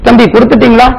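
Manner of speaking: teacher asking. Retold or imitated. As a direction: ask the boy like a teacher. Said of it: "நான் உங்களிடம் ஏதாவது".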